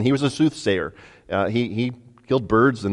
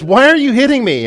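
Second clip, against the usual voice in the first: high, indignant donkey voice, a touch louder; a hurt animal protesting its beating; talking donkey; 11 semitones higher, 5 dB louder than usual. Acted. Donkey to beaten master, high and hurt.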